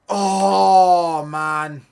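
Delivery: loud, high cry of frustration; anguished shout. Real, not acted. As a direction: bellow it after the miss. Reacting to a near miss.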